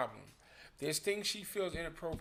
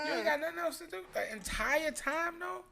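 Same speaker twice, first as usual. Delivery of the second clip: high, louder nagging partner voice; nagging partner voice, pitched up; mocking partner; 10 semitones higher, 4 dB louder than usual. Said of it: "it's like".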